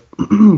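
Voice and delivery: loud throat clear. Real, not acted. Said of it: "sorry, I'm losing my voice".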